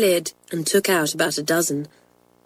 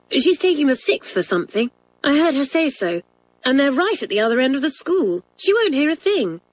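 higher-pitched schoolgirl, assuring her friend; confident schoolgirl Kathleen; 7 semitones higher than usual. Acted. Schoolgirl assures Janet nobody will hear, confident. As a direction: voice a girl insisting it is safe.

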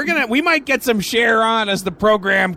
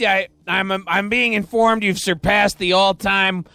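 ordinary talk

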